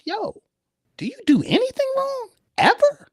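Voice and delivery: very high, squeaky disbelief; incredulous mock question. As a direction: act out disbelief way up in falsetto. Acts out questioning a past partner.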